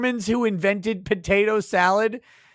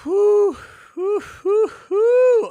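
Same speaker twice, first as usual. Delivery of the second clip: high relieved whew; 12 semitones higher, 5 dB louder, much slower than usual. Real, not acted. Relief after boring court clip.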